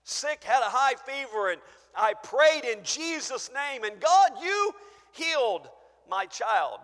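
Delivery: preacher acting a believer pleading at judgment. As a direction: plead your case before the throne. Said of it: "You may be saying these things".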